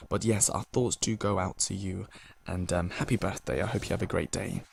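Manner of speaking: soft, low and gentle, like comforting someone who is grieving; sincere sympathy. Real, not acted. DJ comforting a grieving listener on her birthday, softly and tenderly.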